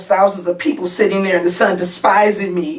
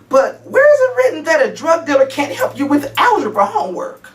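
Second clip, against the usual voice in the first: higher-pitched, indignant challenge; defensive protest; 9 semitones higher, faster than usual. Acted. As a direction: argue back in your own defence. Mother defends herself against daughter's account.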